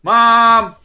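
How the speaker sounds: put-on high, loud whining boy; whiny kid. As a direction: complain to mom like a kid. Brother whines to mom.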